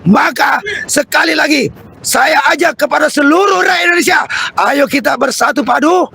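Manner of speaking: rousing protest oratory; rally the crowd like a revolutionary; fiery revolutionary speech. Acted.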